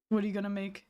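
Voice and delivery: ordinary talk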